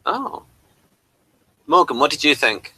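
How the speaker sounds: ordinary talk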